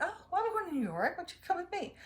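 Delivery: high, quick, lightly acted invitation; retold casual invitation. Mikhail invites Lila along to New York, high quick mock quote. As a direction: toss out the offer fast and high, as a retold quote. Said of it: "And Mikhail's like".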